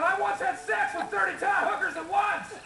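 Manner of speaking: high-pitched mock yell; yelled confession. Trapped Neil confessing in the mine, mock yelling. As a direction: call it out high, as a last confession. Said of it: "Neil started yelling".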